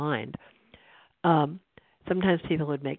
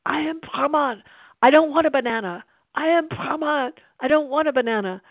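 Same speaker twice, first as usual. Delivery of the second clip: imitated shouting; 11 semitones higher, 8 dB louder than usual. Storyteller imitates the teacher escorted out of the hall, high mock shouting. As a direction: holler it out as if from outside the hall. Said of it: "we could hear him shouting".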